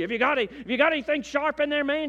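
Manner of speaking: fast, high, brusque security officer; harsh official tone. Retold or imitated. Airport guard interrogating the preacher's wife, brusque. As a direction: bark the question like a suspicious guard. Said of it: "in that tone of voice".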